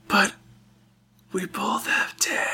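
slow, halting last-gasp whisper; dying whisper. Acted. Game master voices a stabbed, wide-eyed foe's last words, in a slow dying whisper. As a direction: whisper it weakly, with long gaps between the words. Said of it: "wide-eyed, he looks at you and goes".

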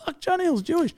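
high, hushed mock shock. Co-host acting out the viewer after googling, high soft mock shock. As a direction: squeak out a soft, pretend realisation. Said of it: "and he's like".

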